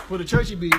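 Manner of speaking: normal talking voice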